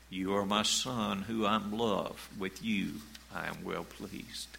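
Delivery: fading down to a hushed, loving close; tender affirmation. Shown as a demonstration. Preacher voicing the Father's love to His Son, hushed and tender.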